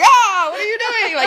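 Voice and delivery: high, loud, slow laughing shock; startled host. Retold or imitated. The host caught off guard by a kiss, mock shocked. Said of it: "the host was like".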